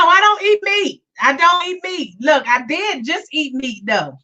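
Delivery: ordinary delivery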